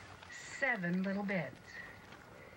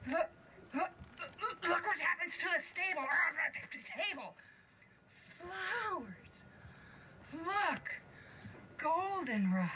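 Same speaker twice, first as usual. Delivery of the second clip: high, quick dwarf voice with a laugh; excited dwarf; 10 semitones higher than usual. Acted. Dwarf showing the others the table, high and excited. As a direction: point everything out in a rush.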